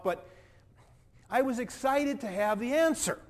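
put-on indignant, self-righteous young man telling his story; a hurt, defensive rich young man recalls meeting Jesus. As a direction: play the proud rich man, wounded and defensive. First-person monologue as the rich young man.

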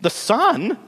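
high-pitched astonished echo; incredulous cry. A narrator's reading. Sisters cry out together at Lily's news, incredulous. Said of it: "cried the sisters together".

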